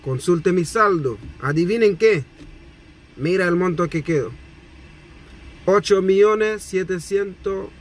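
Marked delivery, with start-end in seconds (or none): none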